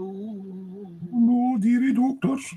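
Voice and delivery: very high, slow, exaggerated dearie doctors; hammed-up Colin. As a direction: stretch dearie out in a silly squeaky voice. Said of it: "Colin's like".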